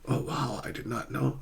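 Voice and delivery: softly voiced mock surprise; hushed amazement. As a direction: murmur it as a quiet reaction. Acting out impressed listener's reply.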